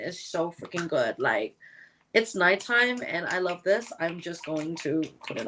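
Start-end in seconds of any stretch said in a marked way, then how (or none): none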